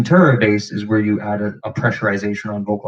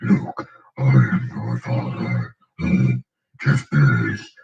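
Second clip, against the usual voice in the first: much lower, slow chest-bass voice; deep chest voice; 10 semitones lower, slower than usual. Shown as a demonstration. Demoing true voice with chest bass, deep and slow. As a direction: drop it into your chest like Darth Vader. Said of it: "join your true voice with your chest bass".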